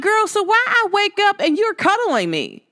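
teasing her little sister, way up in pitch; incredulous to sister. Retold or imitated. Waking up to her sister cuddling, high amused disbelief. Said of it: "And I'm like".

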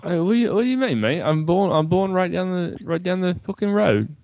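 high, hammed-up mock Aussie-British voice; squeaky-high put-on Aussie accent; bad Australian-British accent. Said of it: "We're both doing British".

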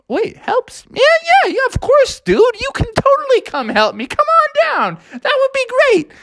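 very high, bouncy squeaky voice; eager chinchilla. Acted. Charles the chinchilla welcoming Froggy's help, very high squeaky voice. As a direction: squeak it like a thrilled little critter.

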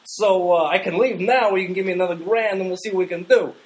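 put-on high, loud goofy Canadian accent. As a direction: play a gouging plumber with a Canadian accent. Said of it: "Stop with the Canadian accent".

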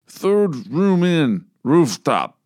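slow, slurred drugged words; slurred speech. Acted. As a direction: drag the words out, barely able to talk. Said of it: "his speech was still slurred".

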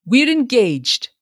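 high, drawn-out happy announcement; breezy, confident declaration; buoyant. A narrator's reading. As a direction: announce it buoyantly, slow and lifted. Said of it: "he assured the wrathful father buoyantly".

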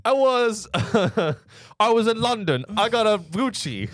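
hammed-up British drill rapper voice, high; mock British accent. Teasing a co-host with his bad British rapper accent. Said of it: "start the British accent by going".

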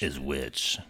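low, quiet spooky voice; scary voice. Shown as a demonstration. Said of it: "The last one you have to say, like, really scary voice".

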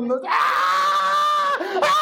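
long, very high mock shriek; imitated screaming. Imitating daughters on the little coaster, wild screaming. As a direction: scream like panicked kids on a ride. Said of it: "Un griterío de padre y señor".

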